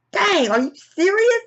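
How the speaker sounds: very high, squeaky disbelief; mock shock, voice leaping way up; incredulous outburst. Acts out own reaction to film.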